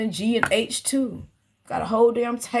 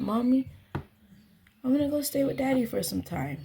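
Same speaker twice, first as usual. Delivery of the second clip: put-on child's words; 5 semitones higher than usual. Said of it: "My child said".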